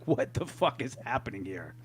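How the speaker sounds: low, hushed disbelief; imagined under-the-breath reaction; retold bafflement. Imagined reaction to a fake accent.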